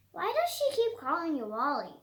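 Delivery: ordinary delivery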